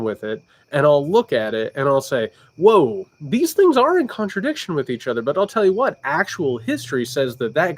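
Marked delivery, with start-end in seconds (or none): 2.6-5.2 mock surprise. Imagined viewer realizing a contradiction.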